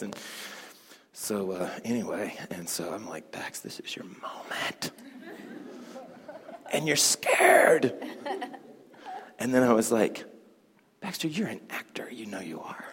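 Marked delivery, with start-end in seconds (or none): 3.3-4.9 slow whispered pep talk to himself; self-pep whisper. Retold or imitated. Nervous performer coaching himself, whispered. 11.0-12.8 hushed whisper of encouragement to yourself; whispered self-talk. Retold or imitated. Nervous performer talking himself into courage, whispered.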